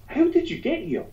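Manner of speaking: very high squeaky question; lampooning a clueless American, high and squeaky; mock naive American. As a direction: ask in a high cartoonish voice. American asking, retold.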